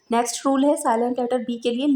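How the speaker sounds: ordinary talk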